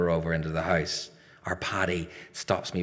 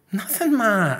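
put-on high, louder child's protest; innocent denial; 12 semitones higher, 6 dB louder than usual. Son dodging ma's questioning, innocent. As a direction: sing out that all is fine. Boy evades ma again.

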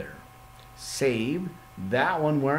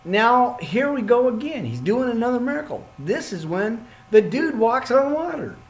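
louder, higher burst of excitement; thrilled, raised voice; excited; 7 semitones higher, 4 dB louder than usual. Real, not acted. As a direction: get fired up about the miracle. Excited Bible reading commentary.

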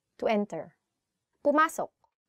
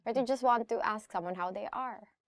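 ordinary talk

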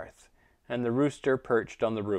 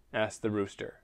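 ordinary delivery, nothing marked

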